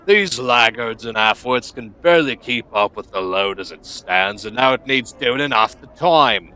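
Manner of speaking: put-upon boss complaining about his workers; scowling gruff foreman. Acted. Foreman scowls at overloaded laborers, gruff and exasperated. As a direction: grumble loudly, rising at the end.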